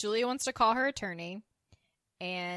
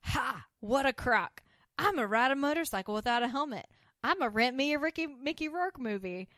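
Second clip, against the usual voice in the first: higher, louder, acted burst of freedom; defiant rant; 5 semitones higher, 6 dB louder than usual. Carlene announcing she's a free woman, defiant acted rant. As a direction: declare it boldly, each boast swooping up.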